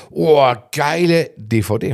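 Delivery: slow, drawn-out mock awe; impressed. Friends seeing the homemade DVD, acted-out admiration. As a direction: imitate someone marvelling, stretching the words. Acts out impressed viewers' reaction.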